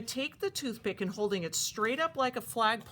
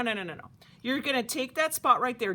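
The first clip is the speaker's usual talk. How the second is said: normal talking voice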